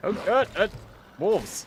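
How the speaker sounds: high, loud flustered alarm; startled and scrambling; panicked stammer. Real, not acted. Wolves appear suddenly.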